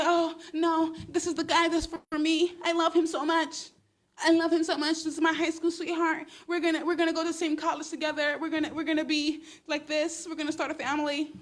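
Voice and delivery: put-on high, gushing lovestruck girl voice; playful high mock crush voice; mock smitten girl. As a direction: gush like a starry-eyed teen in love. Mocks a smitten girl's voice.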